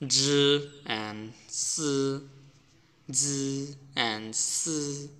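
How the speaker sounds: জি and সি stretched out very slowly; slow pronunciation demo. Shown as a demonstration. Teacher to learners, slowly modelling জি and সি. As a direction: hold each sound of জি and সি slowly, tongue between teeth. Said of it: "এখানে আরও দুটো word দেওয়া আছে".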